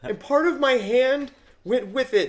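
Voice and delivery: slow, high, loud character voice; high-pitched mock distressed cartoon voice; Donut. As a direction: draw out a high, put-on wounded confession. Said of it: "and Donut's just like".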